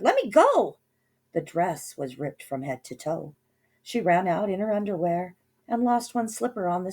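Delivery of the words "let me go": high, loud struggling cry; high, loud cry of a struggling girl; shout. A narrator's reading. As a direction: yell to break free. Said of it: "As Cindy shouted".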